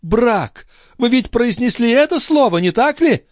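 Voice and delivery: high, anguished accusation; distressed, high-pitched outburst; moaning in horror. A narrator's reading. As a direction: recoil from the word in horror. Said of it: "простонала Андреа".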